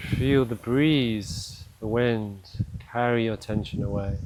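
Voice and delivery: unhurried meditation voice with long pauses; guided meditation. Acted. Coach slowing a stressed listener's morning to a pause, calm guided meditation. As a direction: guide them gently down into stillness, leaving space to breathe.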